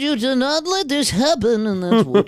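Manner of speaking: put-on old man voice. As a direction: scold like a cranky grandpa. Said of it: "give me your old man impression".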